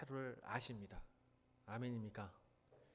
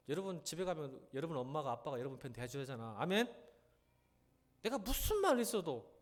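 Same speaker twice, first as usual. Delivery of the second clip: ordinary talk, nothing marked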